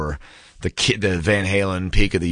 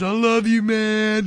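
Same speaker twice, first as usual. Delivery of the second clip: much higher, mock-blubbering voice; mock tearful brothers; slower than usual. Drunk brothers making up after a fight, in a much higher, hammed-up teary voice. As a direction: ham up a weepy drunk hug. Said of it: "they're hugging and crying".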